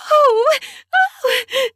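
gasp, then high squealed moans. A narrator's reading. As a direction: squeal breathlessly. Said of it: "so hard I squealed".

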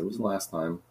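low, rough raspy delivery. Acted. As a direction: rasp it out dark. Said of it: "Just do a raspy voice".

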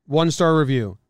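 usual speaking voice

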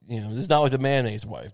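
ordinary talk

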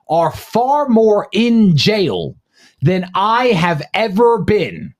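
slow emphatic. Real, not acted. Slow emphatic rhetorical climax.

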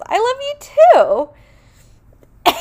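squealed high and loud, drawn out; giddy love reply. Retold or imitated. Rachel answers the misheard confession.

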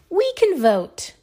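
high, bright exclamation; excited. Acted. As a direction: announce it like a eureka. Dog's triumphant idea.

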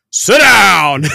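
loud, high imitated shout; barked order. Sensor scolding a rider on the drops, imitated loud shout. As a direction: yell it like a ride's loudspeaker command. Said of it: "just like that".

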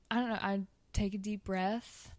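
normal talking voice

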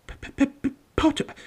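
"Potter" with a high, repeated stuttered P; nervous stammer. A narrator's reading. Quirrell greets Harry, stammering on "Potter". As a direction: stick on the P of "Potter", pitched high. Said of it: "stammered Professor Quirrell".

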